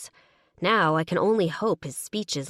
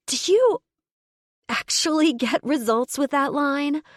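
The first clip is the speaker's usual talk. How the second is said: much higher, louder, choked after swallowing wrong; strangled voice; 9 semitones higher, 5 dB louder than usual. Acted. Woman choking on gin answers his crude line, strangled. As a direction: force it out while still choking. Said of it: "I say in a strangled voice".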